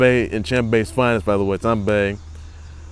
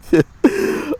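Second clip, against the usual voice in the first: laughing. Real, not acted. Laughing fit.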